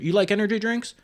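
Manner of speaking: mock salesman pitch.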